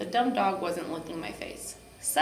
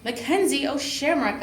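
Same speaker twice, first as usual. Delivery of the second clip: loud, higher yell of his own name; 6 semitones higher, 7 dB louder than usual. A narrator's reading. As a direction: shout it in a temper. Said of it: "I yelled".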